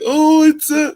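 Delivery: high, loud fake whimper; mocking the people who want him upset; mock crying. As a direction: ham up a crybaby wail. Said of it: "They want me to cry".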